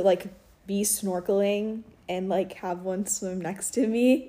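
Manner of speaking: ordinary talk, nothing marked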